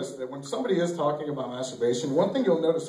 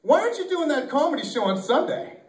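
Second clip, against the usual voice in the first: high-pitched mock scolding from a brother; indignant telling-off, acted out; brother laying into him; 9 semitones higher, 4 dB louder than usual. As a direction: lay into him, pitch jumping up. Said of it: "he lays into me".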